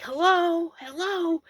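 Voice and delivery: high, loud Arkansas holler; thick Arkansas accent. Retold or imitated. Stranger calling to a parked camper, thick Arkansas accent. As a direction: call out to the house in broad Arkansas twang. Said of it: "in this thick Arkansas accent".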